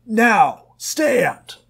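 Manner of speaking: very high, loud barked order; shouted command. A narrator's reading. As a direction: scream the command.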